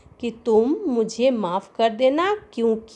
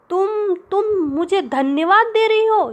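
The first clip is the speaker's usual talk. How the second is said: high, loud stammering outburst at the maid; furious master shouting at Julia; angry shout; 9 semitones higher, 4 dB louder than usual. Acted. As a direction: shout it in a fit of rage. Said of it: "चिल्लाकर बोलता है".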